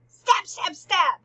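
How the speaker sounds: high, loud bursts on each word; acted frenzy. Queen recounts Hamlet killing the old man, in dramatic bursts. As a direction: punch out each word like a blow.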